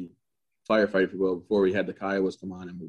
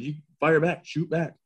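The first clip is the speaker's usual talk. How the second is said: higher-pitched, urgent retold command; retold combat order; 7 semitones higher, slower than usual. Sergeant to soldier under fire, imitated urgent order. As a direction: give the order in a raised pitch.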